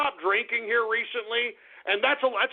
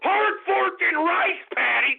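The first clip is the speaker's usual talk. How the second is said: incredulous; 6 semitones higher, slower than usual. Acted. Incredulous repeat of names.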